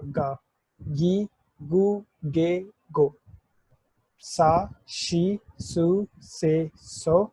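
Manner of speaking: teacher sounding out गा, गी, गु, गे, गो, सा, शी, सु, से, सो syllable by syllable, each vowel held long; slow kana recital. Shown as a demonstration. Teacher reading the voiced kana row aloud to students, slow and deliberate. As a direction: spell out each syllable slowly for beginners. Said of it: "तो गा pronounce होगा".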